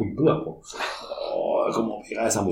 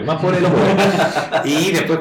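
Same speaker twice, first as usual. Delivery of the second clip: real laugh through the words, higher pitched; 3 semitones higher, 3 dB louder than usual. Real, not acted. Guest jokes to host about family poverty, laughing. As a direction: say it higher while breaking into laughter.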